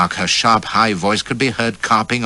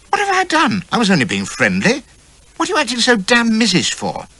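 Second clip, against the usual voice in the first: high louder baffled protest; indignant protest; 4 dB louder than usual. A narrator's reading. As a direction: protest your innocence. Narrator protests to lady.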